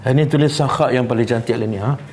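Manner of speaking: normal talking voice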